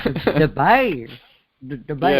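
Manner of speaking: "bear" drawn out slowly in a Midwestern way. Shown as a demonstration. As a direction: repeat "bear" slowly and deliberately. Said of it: "I'm Midwestern, man".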